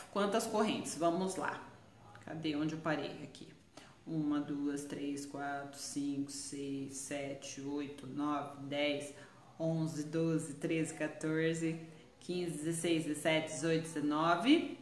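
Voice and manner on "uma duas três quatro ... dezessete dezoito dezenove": soft, low murmured counting; counting under breath. Real, not acted. Crocheter counting her chains in a soft murmur. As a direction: count the chains quietly to yourself.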